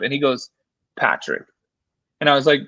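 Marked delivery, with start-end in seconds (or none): 1.0-1.4 grave tone. Retold or imitated. Mill owner's serious phone call.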